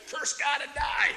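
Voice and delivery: loud, high jeering shout of a bitter wife; mock shout. Wife hurling a curse at Job, shouting. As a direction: spit out the curse as Job's wife.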